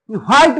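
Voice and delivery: "व्हाईट" with a breath of H at the front; wrong pronunciation. Shown as a demonstration. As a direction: open "व्हाईट" on a sounded H. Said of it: "व्हाईट नाही म्हणायचं". ("व्हाईट", ह उच्चारून)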